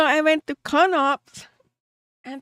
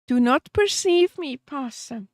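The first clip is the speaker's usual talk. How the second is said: ordinary talk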